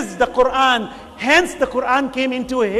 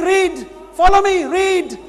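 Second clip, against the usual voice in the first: shouted command; 9 semitones higher, 8 dB louder, slower than usual. Retold or imitated. Jibreel urging the Prophet to read.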